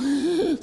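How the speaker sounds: high, loud gasp for air; sharp gasp. Retold or imitated. Man mimicking a covid patient choking, a sharp gasp. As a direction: gasp as if suffocating.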